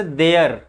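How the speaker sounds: देयर with the final r sounded; r pronounced. Shown as a demonstration. Teacher showing the common reading, देयर with r pronounced. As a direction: voice the r at the end of देयर. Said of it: "इसको हम लोग बोलते हैं ऐसे".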